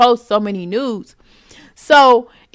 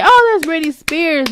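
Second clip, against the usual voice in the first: excited recognition; 6 semitones higher than usual. Pretend. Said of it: "I wanna say".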